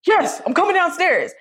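called reply. Retold or imitated. Dad answering his daughter from upstairs, calling down. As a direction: call down that you're on your way.

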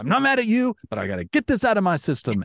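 high, bursting frustration then fast; venting outburst. Retold or imitated. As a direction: let it all out in a rush. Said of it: "and it's like".

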